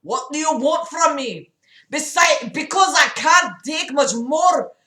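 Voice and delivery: hammed-up, high, desperate outburst; overwhelmed crying. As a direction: build from pleading to a loud, overwrought cry. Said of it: "John cried from overwhelming emotions".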